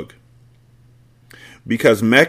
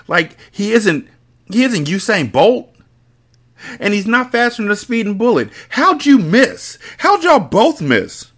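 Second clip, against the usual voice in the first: exasperated rant; 9 semitones higher than usual. Real, not acted. Reader ranting at the book's gunmen, exasperated. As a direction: scold the shooters in disbelief. Reader rants at characters' failure.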